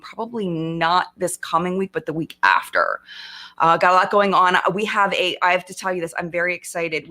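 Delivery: normal talking voice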